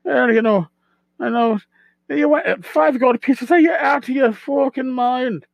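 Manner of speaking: high, quick little leprechaun haggling over price. Shown as a demonstration. Game master voicing a leprechaun merchant haggling with players, high and lively. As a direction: play a cheeky leprechaun merchant working the players. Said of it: "he's talking like this".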